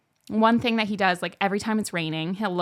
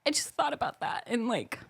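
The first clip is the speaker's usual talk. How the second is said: higher and softer, close to tears; teary; 7 semitones higher, 5 dB quieter than usual. Real, not acted. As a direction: talk softly through tears. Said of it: "I didn't know I was gonna cry".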